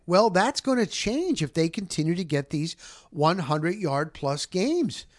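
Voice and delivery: ordinary talk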